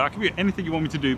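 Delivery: normal talking voice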